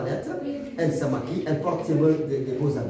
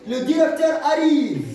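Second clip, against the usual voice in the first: loud, high call of an arrival; announcing aloud; 9 semitones higher, 5 dB louder than usual. Shown as a demonstration. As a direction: announce it like news for the class. Teacher acts out an announcement.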